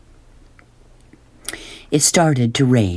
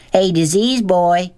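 slow, high jeering call; stretched-out storybook shout; mock shouted taunt; 8 semitones higher than usual. A narrator's reading. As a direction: call out a bully's taunt, volume held back. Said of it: "Norton shouted".

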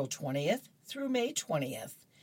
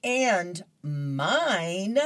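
louder, higher, smug and pleased; a lightly voiced cat showing off; smug Garfield; 3 semitones higher, 5 dB louder than usual. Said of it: "points down to his plate and goes".

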